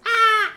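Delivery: short high piercing shriek; shrill shriek. Shown as a demonstration. Teacher yelps to show a shrill voice. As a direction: let out a shrill yelp. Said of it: "That is a high pitched voice".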